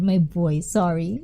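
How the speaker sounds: ordinary delivery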